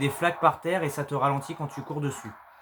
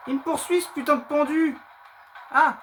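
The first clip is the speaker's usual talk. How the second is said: panicking gamer; 11 semitones higher than usual. Real, not acted. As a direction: yell while being chased. Chased by monster.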